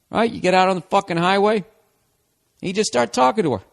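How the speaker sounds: normal talking voice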